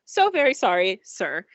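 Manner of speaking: a droid bowing and scraping; apologetic droid. Acted. Old droid apologizing to the passenger, fussy and high. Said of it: "looks and says".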